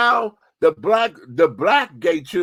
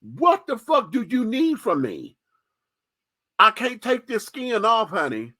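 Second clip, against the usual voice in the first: retold exasperated plea; 5 semitones higher than usual. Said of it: "To sit there and say".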